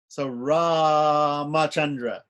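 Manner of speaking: "Ramachandra" stretched out syllable by syllable, half speed; slow demo pronunciation. Shown as a demonstration. Teacher showing students how Ramachandra is spoken, drawn out slowly. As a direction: spell "Ramachandra" out slowly for the class. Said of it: "the root of Ramachandra is Ra".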